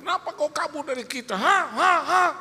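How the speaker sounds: high, fast demand ending in loud barks; retold yell. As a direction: imitate an irate collector demanding answers. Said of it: "Sampai dia teriak". (teriakan penagih utang, tinggi mendesak)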